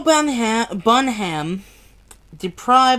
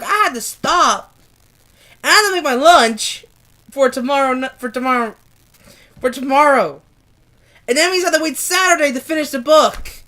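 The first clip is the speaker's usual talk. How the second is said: exasperated complaining; 5 semitones higher, 5 dB louder than usual. Real, not acted. Complaining about long foreword.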